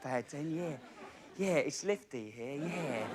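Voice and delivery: mild, lightly voiced dim Lofty; Lofty from EastEnders. Comedian sends up the EastEnders character. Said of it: "I've still got the I Q of a kipper".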